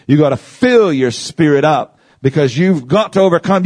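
ordinary talk, nothing marked

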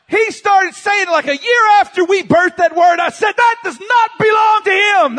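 high, loud indignant shouting; hammed-up preacher's outrage; mock-outraged preaching. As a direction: protest at full voice, defending your own word. Preacher's mock outrage at full force.

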